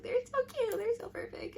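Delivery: giddy fangirl squeal. Retold or imitated. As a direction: gush like an overjoyed fan. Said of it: "I'm just like".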